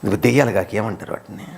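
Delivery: ordinary talk, nothing marked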